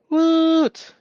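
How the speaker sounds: high, loud celebratory cry; bright emoji-style whoop; cheering woot. Retold or imitated. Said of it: "the celebrate emoji".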